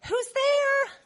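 put-on high, lightly sung-out girl's call; young girl's voice. Little Rhoda calls through the door, curious. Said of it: "she's like".

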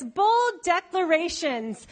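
ordinary talk, nothing marked

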